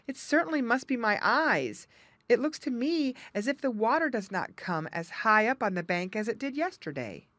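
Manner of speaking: lightly voiced worried muskrat. Jerry worries about the water.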